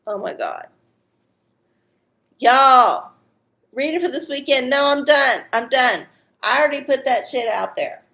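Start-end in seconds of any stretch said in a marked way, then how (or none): 2.4-6.1 louder, raised-pitch protest; emphatic refusal. Real, not acted. Host refusing a late reading request, emphatic refusal. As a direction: put your foot down loudly.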